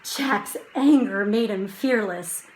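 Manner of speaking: usual speaking voice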